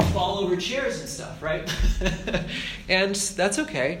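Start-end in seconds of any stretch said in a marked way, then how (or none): 1.7-2.8 a real little laugh at yourself; quick amused chuckle; light laugh. Real, not acted. Laughing at falling over chairs.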